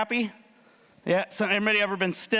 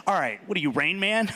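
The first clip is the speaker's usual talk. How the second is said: ordinary delivery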